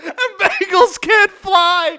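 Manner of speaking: delivering the punchline through a high laugh; punchline breaks into loud laughter. Real, not acted. Cracking up while reading the bagel joke, laughing shout.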